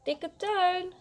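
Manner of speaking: high singsong call for the cat. A narrator's reading. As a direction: call a pet from across the garden. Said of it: "roept hij dan".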